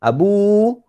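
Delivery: put-on high, loud call to a child; acted mum's summons; mother calling. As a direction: call out the name, last vowel drawn out. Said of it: "Mak kita panggil".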